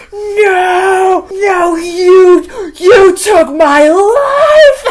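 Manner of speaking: screamed very high and loud, with a fake sob; mock anguished scream. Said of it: "Florence Pugh is giving it her all".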